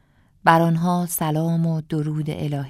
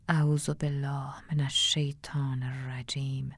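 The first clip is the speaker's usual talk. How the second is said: slow, drawn-out awed whisper of بسم الله; slower whispered prayer; 2 semitones lower, 7 dB quieter than usual. Acted. Narrator repeats the prayer, slower and more hushed. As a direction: stretch the prayer out in a fearful whisper.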